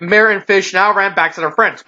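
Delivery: ordinary delivery, nothing marked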